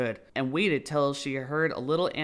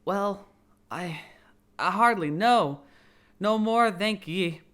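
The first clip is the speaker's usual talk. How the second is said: lightly voiced feeble little lizard; a hint of a weak, shaken creature; feeble squeak; 5 semitones higher, 5 dB louder than usual. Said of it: "a little feeble squeaking voice".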